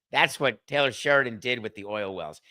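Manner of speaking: usual speaking voice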